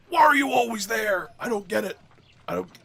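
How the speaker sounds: high exasperated yell at the screen; frustrated shout. Real, not acted. Gamer yelling at the game in exasperation. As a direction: shout it, fed up and baffled.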